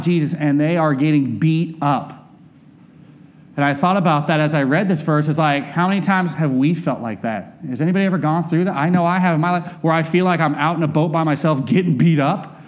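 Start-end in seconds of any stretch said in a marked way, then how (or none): none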